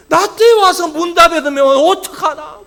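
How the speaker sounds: loud, high retold lament; anguished outcry. Pastor dreading closure on his watch, anguished outcry. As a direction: agonise aloud at full pulpit volume. Retold worry over closing chapel.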